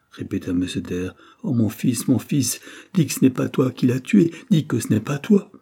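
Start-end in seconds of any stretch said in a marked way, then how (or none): 1.4-5.5 loud, high, grief-stricken appeal; anguished pleading. A narrator's reading. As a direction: beg your son, broken by grief, voice raised. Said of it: "brisé par la douleur, se jeta sur le lit en sanglotant".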